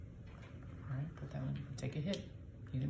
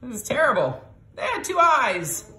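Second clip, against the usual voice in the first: dismayed; 10 semitones higher, 10 dB louder than usual. Real, not acted. Mom groans over bad roll.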